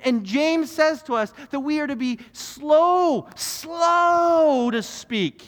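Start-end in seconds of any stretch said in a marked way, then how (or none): 2.3-5.4 "slow" dragged long for emphasis; drawn out slow. Real, not acted. Preacher urging the congregation to be slow to speak, drawn out. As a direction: stretch SLOW way out, high and loud.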